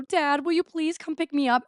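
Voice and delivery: high pleading request. Retold or imitated. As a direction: beg dad for a ride. Begging dad on phone.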